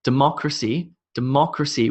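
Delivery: democracy as de-MOC-racy, rising; democracy stressed on the second syllable; emphasis on MO. Shown as a demonstration. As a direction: put the beat on the MOC of democracy. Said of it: "with emphasis on the mo".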